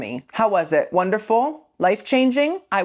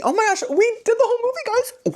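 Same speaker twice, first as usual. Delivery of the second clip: much higher, fast, squealing excitement; giddy celebration; faster than usual. Real, not acted. Vlogger cheering to viewers for finishing the recap, fast squealing excitement. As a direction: gush about finishing the whole movie.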